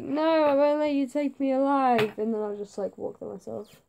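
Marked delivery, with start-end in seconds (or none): 0.0-2.2 mock defiance. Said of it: "I'd probably be like".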